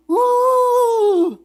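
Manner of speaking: sharp high yelp; high squeal. Acted. Armadillo's reaction sound.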